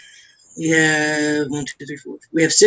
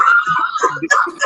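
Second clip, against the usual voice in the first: howls at the joke; shrieking laugh. Real, not acted. Cracking up after the quote.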